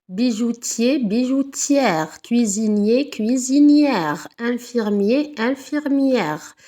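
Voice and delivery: slow pairs, feminine endings "-ière" stretched; teacher drilling masculine and feminine forms; slow word list. Shown as a demonstration. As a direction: read out each pair slowly for pupils. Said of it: "je rajoute le E".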